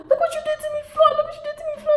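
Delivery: high Jamaican grandma wailing; lightly voiced Jamaican grandma lament. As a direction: imitate a Jamaican grandma crying over the damage. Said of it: "my grandma's Jamaican".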